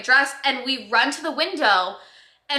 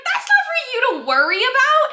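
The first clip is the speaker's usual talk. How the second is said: high, loud mock protest; hammed-up reassurance, pitched way up; imitated insistent videographer; 12 semitones higher, 5 dB louder than usual. Videographer dismisses the bride's worry.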